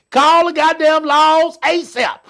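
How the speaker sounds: loud, slow comic yell. Acted. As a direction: drag out a loud plea. Comic fight plan, yelled.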